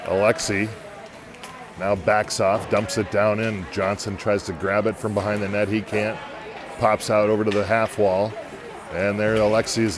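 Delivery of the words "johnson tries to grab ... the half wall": ordinary delivery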